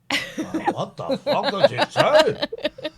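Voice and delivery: slow, laughing disbelief. Real, not acted. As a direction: laugh through the words. Host reacts to cheeky jab.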